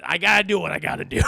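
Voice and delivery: loud, fast, then cackle; mock menacing shout. [name] telling Max what she must do, loud then laughing. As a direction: blurt the threat then laugh.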